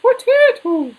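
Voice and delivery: high loud Irish "potato" shouted; Irish accent. Retold or imitated. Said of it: "That's how the Irish say potato".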